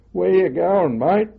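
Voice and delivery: loud, high Cockney shout; shouted Cockney greeting from up the road; Cockney yell. Retold or imitated. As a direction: call out like a British Tommy in Cockney. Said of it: "he yelled out in his Cockney accent".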